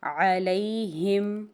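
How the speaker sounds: علیہم said slowly, with ہم run together smoothly; joined ha-meem. Shown as a demonstration. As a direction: glide the ہ into the م in علیہم. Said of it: "میم کو ہا کے ساتھ ملائیں گے". (علیہم میں ہا اور میم ملا کر)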